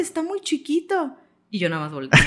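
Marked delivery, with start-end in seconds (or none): 0.0-1.2 higher, drawn-out imitation of the locals; mocking Morelia locals. Friends from Morelia dismissing Mérida, lightly imitated in a higher voice. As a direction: parrot their dismissive tone lightly. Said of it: "Y me dicen".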